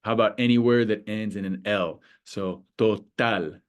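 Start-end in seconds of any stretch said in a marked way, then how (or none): 2.8-3.6 slow "total", weight on TAL; stress on final syllable. Shown as a demonstration. Teacher giving a word ending in L, slow "total" stressed on TAL. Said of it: "it falls on the final syllable".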